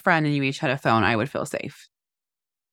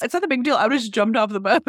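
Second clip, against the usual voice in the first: much higher, breezy teen imitation; mock teen brush-off; 6 semitones higher, faster than usual. Mother imitating daughter dismissing the danger, high and breezy. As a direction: shrug it off like a careless teen. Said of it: "And Gabby's".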